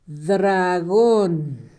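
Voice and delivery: "dragón" stretched slowly, syllable by syllable; slow, drawn-out "dragón" for dictation; sounding out. Shown as a demonstration. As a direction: draw out each syllable of "dragón" as if writing it. Sounds out word while writing.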